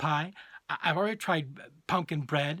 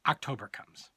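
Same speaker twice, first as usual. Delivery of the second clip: ordinary delivery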